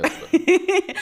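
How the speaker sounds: giggling through the words; laughing. Real, not acted. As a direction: laugh while admitting it.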